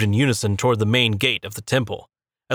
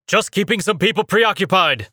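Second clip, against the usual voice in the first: screamed over combat; 8 semitones higher than usual. A narrator's reading. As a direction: yell it over the fighting. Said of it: "he screamed over the sounds of combat".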